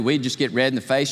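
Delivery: usual speaking voice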